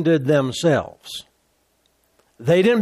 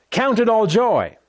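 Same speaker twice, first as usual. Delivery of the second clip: higher and bolder, proclaiming it; bold restated verse; 5 semitones higher than usual. Shown as a demonstration. Preacher proclaiming the verse boldly to the church. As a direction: ring the verse out. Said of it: "verse repeated high".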